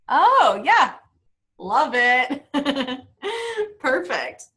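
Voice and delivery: ordinary talk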